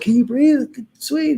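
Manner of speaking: high, urgent retold question; panicked. Partner checking he can breathe after resuscitation, panicked. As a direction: voice leaps up in imitated alarm.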